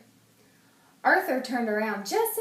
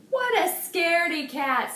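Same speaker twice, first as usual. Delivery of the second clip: teasing; 8 semitones higher, 4 dB louder than usual. A narrator's reading. Francine teasing Arthur.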